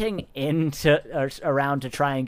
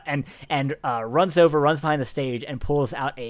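normal talking voice

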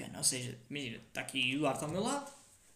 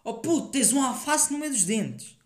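loud call across the room, pitched up; shouting it out; 6 semitones higher, 4 dB louder, slower than usual. Retold or imitated. Mate with lettuce in his teeth, loud shout. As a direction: yell it for all to hear. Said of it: "Tipo, gritam".

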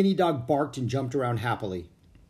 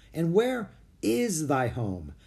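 slow, higher, deliberate questioning; unfriendly; 5 semitones higher, slower than usual. A narrator's reading. Captain Standish questions the children, not so friendly. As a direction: press the stranger suspiciously. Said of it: "He didn't sound as friendly".

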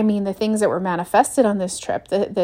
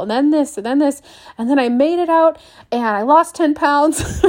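higher, hammed-up disaster tale; mock excited retelling; 5 semitones higher, 3 dB louder than usual. Friend telling family a wild travel mishap, mock excitement. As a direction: pile on the drama with a grin.

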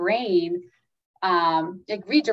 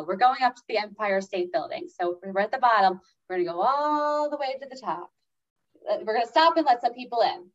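put-on sing-song breathing count for a child, rising on the climb; rhythmic guiding; 4 semitones higher than usual. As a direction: lead the kid through the breath like an elevator ride. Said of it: "in this rhythmic kind of way".

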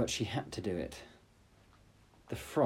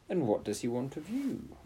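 king voice; 5 semitones higher than usual. Acted. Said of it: "asked the king".

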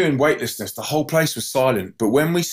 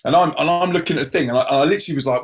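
plain reading voice

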